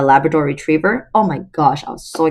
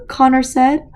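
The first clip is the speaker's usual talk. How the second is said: usual speaking voice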